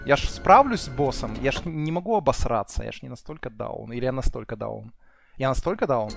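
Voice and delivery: ordinary talk, nothing marked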